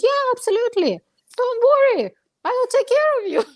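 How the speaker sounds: high, bright, fast reassurance; sweet promise from the saleswoman; reassuring saleswoman. Retold or imitated. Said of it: "She goes".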